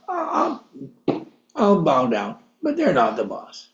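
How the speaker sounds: grudgingly bow, but keep the upper hand; a hint of a smug king muttering; proud king. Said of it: "I think he basically said".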